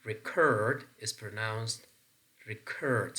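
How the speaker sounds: "record" as re-CORD, slowly shown; "record" with a strong second syllable; stress on second syllable. Shown as a demonstration. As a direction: demonstrate the verb "record", leaning on CORD. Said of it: "See, curd is in bold".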